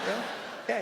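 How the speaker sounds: ordinary talk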